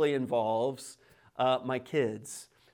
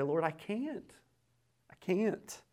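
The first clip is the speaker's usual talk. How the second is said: soft, hushed admission; humble prayer; 4 semitones higher, 8 dB quieter, slower than usual. Acted. Believer to the Lord over Romans eight, softly confessing. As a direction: confess helplessness softly, voice dropping in level. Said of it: "look at the Lord and say".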